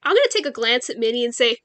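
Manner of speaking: normal talking voice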